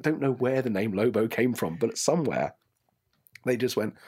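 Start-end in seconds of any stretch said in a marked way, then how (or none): none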